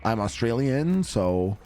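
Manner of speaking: put-on halting French-accented English with slow hesitations. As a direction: imitate an Australian woman with an accidental French accent. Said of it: "She sounds French".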